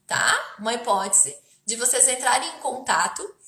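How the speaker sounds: ordinary talk, nothing marked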